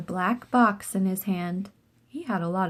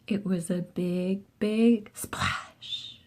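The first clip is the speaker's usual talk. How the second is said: whispered splash; 4 dB quieter, much slower than usual. A narrator's reading. Narrating the splash.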